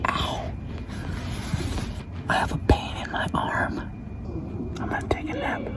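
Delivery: very soft hiding whisper. Real, not acted. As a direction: barely breathe the words. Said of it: "hiding, whispering".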